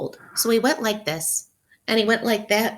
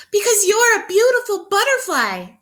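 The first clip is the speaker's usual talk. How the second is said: high monkey voice; 11 semitones higher, 6 dB louder than usual. Acted. Monkeys answer in character.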